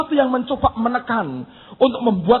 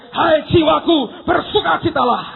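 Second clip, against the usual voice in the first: raised, stretched-out call; rallying shout; 4 semitones higher, 3 dB louder than usual. Acted. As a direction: cry out to your own soul. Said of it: "engkau akan berteriak".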